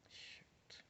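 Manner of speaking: whispered under the breath; whispered frustrated shoot. Real, not acted. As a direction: hiss it to yourself. Frustrated while gaming.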